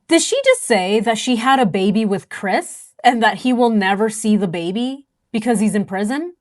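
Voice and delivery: usual speaking voice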